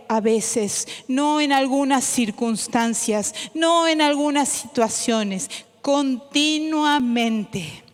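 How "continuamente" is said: "continuamente" slowed right down and held; slow emphatic. Shown as a demonstration. Preacher closing the list by slowing on "continuamente". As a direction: land "continuamente" slowly with weight. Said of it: "no algo esporádico".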